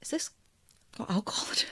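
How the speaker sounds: a hushed, unsure question over a drink; confused whisper. Retold or imitated. Whispering to herself after tasting the cocktail, puzzled. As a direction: whisper it, puzzled, mid-sip. Retelling sipping a surprise Negroni.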